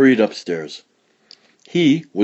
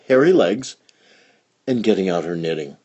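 plain reading voice

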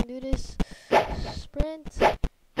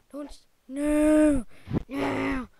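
panicked cry; 3 semitones higher, much slower than usual. Real, not acted. Panicking in the game.

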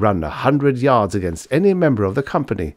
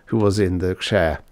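ordinary delivery

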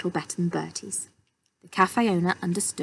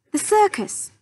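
high, loud call to the owner; calling out to get attention; shouted; 13 semitones higher, 4 dB louder than usual. A narrator's reading. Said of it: "he shouted in English".

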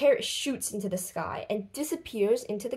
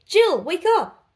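loud and high wake-up call; 9 semitones higher, 8 dB louder than usual. Acted. Boy waking Jill after a hundred years, loud call. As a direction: rouse her, loud and urgent.

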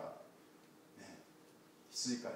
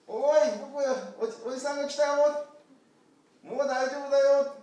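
high, loud comforting call; calling out reassurance; 11 semitones higher, 10 dB louder than usual. Acted. Shepherd calling down to the lamb, loud and reassuring. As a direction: call down that help is here.

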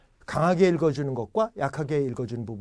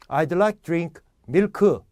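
put-on slow, loud Korean-accented order, milk as mil-keu; earnest Korean-accented milk, two syllables; Korean learner's English; 2 semitones higher, 5 dB louder, much slower than usual. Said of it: "왜 못 알아들을까요?".

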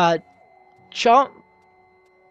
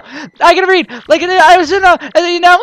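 usual speaking voice

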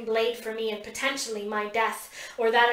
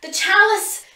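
high, loud shout; urgent shout from behind; 10 semitones higher, 9 dB louder than usual. A narrator's reading. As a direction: yell it across the trees. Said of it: "Pip shouts".

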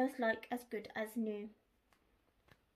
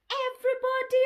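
high, loud squawked alarm; squawking cockerel; 12 semitones higher, 9 dB louder than usual. Acted. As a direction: squawk it like a panicked rooster. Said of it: "the cockerel flapped and squawked".

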